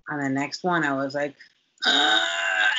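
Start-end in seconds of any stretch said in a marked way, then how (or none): 1.8-2.7 loud high strained groan; imitating a labor groan; pushing groan. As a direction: let out a loud high grunt of effort. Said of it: "I remember that sound".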